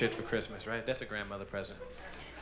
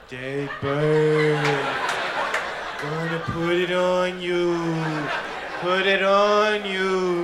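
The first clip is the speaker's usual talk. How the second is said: slow, drawn-out teasing; kid taunt; 5 semitones higher, 7 dB louder, much slower than usual. Retold or imitated. Kid threatens the girls with a dead bird, slow taunting. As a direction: drag out the threat. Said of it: "walk around and say".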